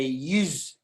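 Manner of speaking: "yous" as one syllable, you plus a final z; Irish dialect yous. Shown as a demonstration. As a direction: give "yous" the local Irish ring, ending in z. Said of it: "people say".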